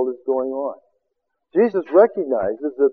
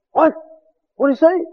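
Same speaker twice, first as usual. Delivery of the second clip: high, disbelieving double-take; disciples stunned; 12 semitones higher than usual. Acted. Disciples react to Jesus' words, high and stunned. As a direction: jump way up in shocked disbelief.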